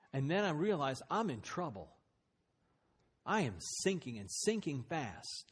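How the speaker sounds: usual speaking voice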